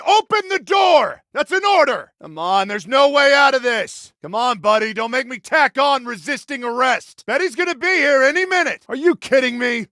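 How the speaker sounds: high, loud, exasperated demand; insistent, frustrated shouting; shouted police order. Acted. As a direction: yell through a closed door. Shouting at barricaded suspect.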